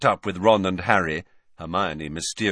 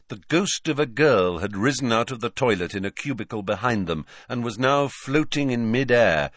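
ordinary delivery

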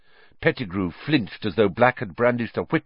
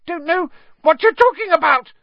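very high, loud shriek of protest; Pettigrew shrill denial; 9 dB louder than usual. A narrator's reading. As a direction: shrilly deny it, panicking. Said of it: "more shrilly than ever".

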